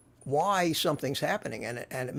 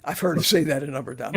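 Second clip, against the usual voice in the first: usual speaking voice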